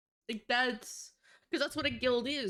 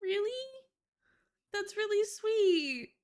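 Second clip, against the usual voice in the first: touched surprise; 9 semitones higher than usual. Retold or imitated. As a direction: gush back at an anonymous thank-you. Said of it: "And I'm like".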